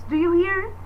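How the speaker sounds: ordinary delivery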